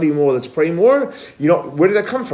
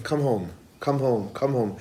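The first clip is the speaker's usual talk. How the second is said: softly repeated low-volume call; 3 semitones lower, 7 dB quieter than usual. Acted. A voice out there calling people home, soft call. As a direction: repeat it softly, like a faint loudspeaker. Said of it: "Unfortunately, it's not so loud".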